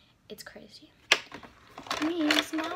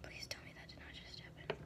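whispered disbelief; stunned hush; dismayed whisper; 12 dB quieter than usual. Real, not acted. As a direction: whisper it, hoping it's not true. The blush breaks again.

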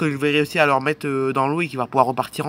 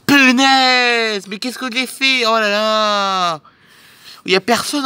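higher, louder voice of a boat captain in dismay; a captain crying out at his stranded boat, dragging out the last "Oh là là"; 6 semitones higher, 5 dB louder, much slower than usual. Acted. Boat captain to himself on the beach, stretched-out dismayed cry.

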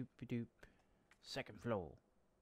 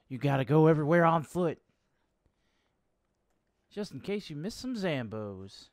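mock exasperated yell; loud sarcastic rant; 4 semitones higher, 16 dB louder than usual. Real, not acted. Sarcastic rant about no fast travel.

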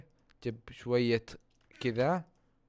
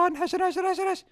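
panicked; 14 semitones higher than usual. Real, not acted. Fleeing danger in game.

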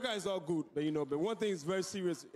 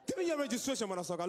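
cop demand; 5 semitones higher, faster than usual. Acted. Cop checking a clubgoer.

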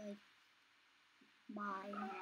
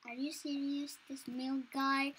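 ordinary talk, nothing marked

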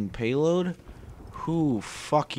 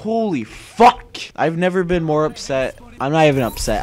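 furious outburst; 3 semitones higher, 9 dB louder than usual. Real, not acted. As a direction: blow up at the screen. Raging at the game.

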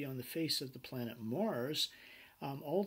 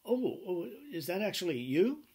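surprised client; 6 semitones higher, 5 dB louder than usual. Retold or imitated. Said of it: "the client would go".

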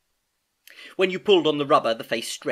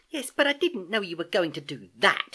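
higher, indignant objection; protesting; 8 semitones higher, 4 dB louder than usual. A narrator's reading. Wet niece objecting to uncle's trick, protesting. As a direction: push back, put out. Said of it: "she protested".

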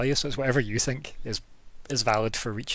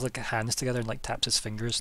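ordinary talk

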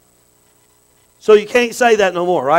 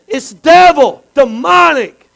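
loud, high preaching shout; emphatic denunciation; 8 semitones higher, 11 dB louder, much slower than usual. Real, not acted. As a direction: hammer it home as a warning. Preacher denounces pampering sick children.

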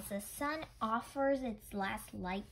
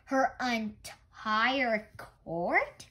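slow, louder disbelieving echo; surprised question; 7 dB louder, much slower than usual. Acted. Grandfather echoes the child's claim, slow and surprised. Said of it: "Grandfather asked".

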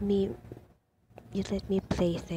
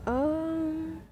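frightened cry; 8 semitones higher, much slower than usual. Real, not acted. Scared in horror game.

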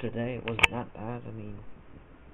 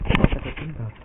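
"green belt" called out much louder; proud shout; 2 semitones higher, 10 dB louder than usual. Real, not acted. Kid proudly shouts his karate belt to viewers. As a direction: announce it loud and proud.

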